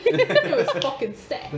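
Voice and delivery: high, drawn-out mock English accent with laughter. Teasing with the cousin's catchphrase, laughing English accent. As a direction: giggle through a slow English accent.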